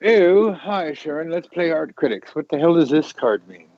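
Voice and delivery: high sniffy critic voice; snooty art critic. Retold or imitated. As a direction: play a snobby gallery critic. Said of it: "It's like".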